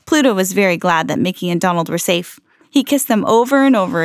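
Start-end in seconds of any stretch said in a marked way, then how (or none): none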